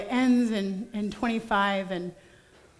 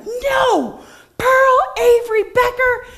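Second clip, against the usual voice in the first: sharp high shout of a scared parent; angry yell; 11 semitones higher, 5 dB louder, slower than usual. Retold or imitated. Mother yelling at toddler running into the alley, furious high shout. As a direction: yell her full name in alarm. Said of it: "I had this explosion of anger".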